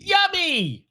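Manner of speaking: very high, drawn-out squeal of the title; hammed-up title announcement. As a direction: sing it out high and silly. Repeats silly film title theatrically.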